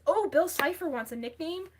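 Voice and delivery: normal talking voice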